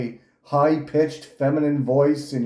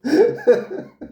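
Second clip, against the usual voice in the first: shrill burst of laughter, pitched way up; high squealing laugh; 3 dB louder than usual. Real, not acted. Laughing at the YouTuber's voice, high squeal.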